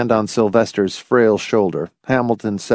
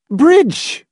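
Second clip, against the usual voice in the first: very high, emphatic matron; 4 dB louder than usual. A narrator's reading. Hostess declaring the game in another room, emphatic. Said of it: "was saying emphatically".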